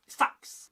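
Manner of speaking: "Sax" clipped like a blade strike; short, sharp. Shown as a demonstration. Teacher demonstrating Northumbrian word, clipped sharp "Sax". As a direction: hit "Sax" hard and short. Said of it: "like the strike of the blade".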